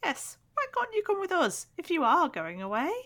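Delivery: put-on very high, squeaky small child calling up the stairs; eager little boy's voice far above the narrator's own; weird little boy voice. A narrator's reading. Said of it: "giving Ronnie a really weird voice".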